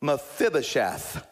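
Mephibosheth said slowly and clearly; measured demo of a tricky name; careful pronunciation. Shown as a demonstration. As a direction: sound out the name at an unhurried pace. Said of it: "Try to pronounce that".

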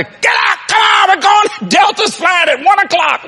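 mock shouting to hurry his wife; hollering. Preacher retelling hollering at Kathy to rush to the airport. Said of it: "I hollered at Kathy".

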